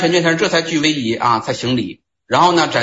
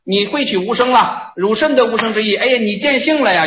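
high, rising praise; delighted master; 8 semitones higher than usual. Retold or imitated. Sixth Patriarch praising Yongjia for seeing his nature, in a raised joyful voice. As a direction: lift the voice in glad approval.

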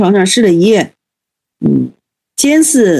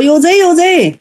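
cry of alarm; 8 semitones higher than usual. Retold or imitated. Elder repeats his cry of thief.

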